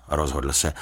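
plain reading voice

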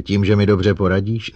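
mock the offer of help; disdainful challenge; scornful. A narrator's reading. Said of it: "zeptala se ho opovržlivě".

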